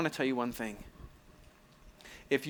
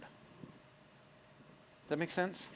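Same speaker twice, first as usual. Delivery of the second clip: soft aside; 3 semitones higher, 13 dB quieter, much slower than usual. Real, not acted. Pastor checks in with listeners.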